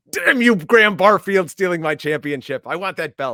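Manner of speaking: loud, high shout at a rival; mock outrage. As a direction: shake your fist at him, hammed up. Mock rage at league rival.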